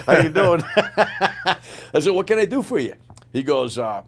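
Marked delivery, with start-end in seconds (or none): 0.1-2.9 higher, louder, breaking up with laughter; laughing. Real, not acted. Sergeant major greeting the colonel, retold laughing.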